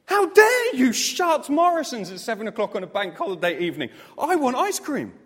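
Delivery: half-laughing, loud and high, mock indignant; hammed-up childish tantrum over the shut shop; mock outrage. Preacher retelling the closed supermarket, laughing mock tantrum. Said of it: "I was so angry".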